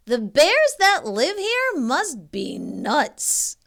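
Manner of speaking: put-on exasperated little girl; indignant complaint. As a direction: complain loudly about the bears. Goldilocks complaining.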